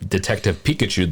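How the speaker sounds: ordinary talk, nothing marked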